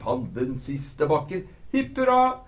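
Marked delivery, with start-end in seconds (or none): 1.7-2.4 high, loud hooray; joyful cheer. A narrator's reading. Moomintroll cheering as he storms the hill, high and loud. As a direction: shout it out in triumph.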